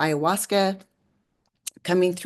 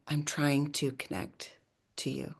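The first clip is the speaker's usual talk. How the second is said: quiet plea; 3 semitones lower, 10 dB quieter than usual. Retold or imitated. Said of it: "I put my hands on the sink and I'm like".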